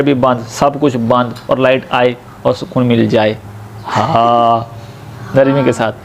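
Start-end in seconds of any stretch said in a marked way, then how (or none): 3.9-5.9 हा breathed low and gently like relief; soft relieved ha. Shown as a demonstration. Teacher demonstrates the soft ha to students. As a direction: sigh out हा softly. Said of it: "ये नरमी वाला है".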